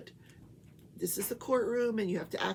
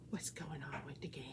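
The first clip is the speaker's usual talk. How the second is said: soft unvoiced whisper; breathy whispered question; 8 semitones lower, 13 dB quieter than usual. Real, not acted. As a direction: hush it like a secret. Hushed aside to pet.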